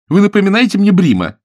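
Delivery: высоко и громко, с досадой; оборвать его криком; раздражённый крик. Acted. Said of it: "закричала на него Билли". (девушка кричит на надоедливого жениха, громко и высоко)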